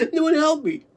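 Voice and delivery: ordinary talk, nothing marked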